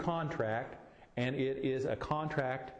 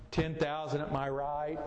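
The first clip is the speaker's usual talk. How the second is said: usual speaking voice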